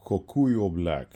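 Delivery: slow, exaggerated mock-exotic voicing of Kokuyo; funny put-on voice. Shown as a demonstration. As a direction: savor the brand name in a playful put-on voice. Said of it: "why do I talk like this when I talk".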